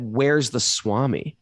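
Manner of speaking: drawn-out, slow asking; slow question. Retold or imitated. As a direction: ask it slowly, searching.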